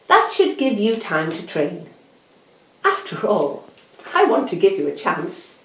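loud, confident storybook hare; cocky hare, condescending. Acted. Hare sets race terms for Tortoise, loud condescension. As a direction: lay down the terms loudly and grandly.

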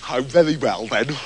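low, deflated surrender; resigned. Acted. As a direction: give in grudgingly. Septimus gives in.

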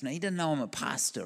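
normal talking voice

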